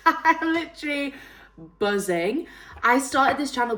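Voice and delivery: excited. Real, not acted. Thrilled at five hundred subscribers.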